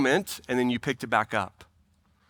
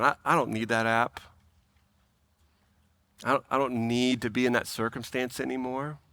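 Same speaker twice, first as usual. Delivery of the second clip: quiet letting go; 5 semitones lower, 5 dB quieter than usual. Acted. Acting out letting go to Jesus.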